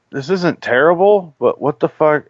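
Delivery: acting out growing disbelief; retold bafflement. Moviegoer recalling his reaction twenty minutes into the movie, baffled.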